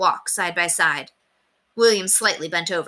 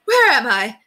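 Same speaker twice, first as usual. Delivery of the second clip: high, loud cry of bewilderment; loud, high, startled question; frightened cry; 6 semitones higher, 6 dB louder than usual. A narrator's reading. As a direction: cry out, lost and alarmed. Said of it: "she cried".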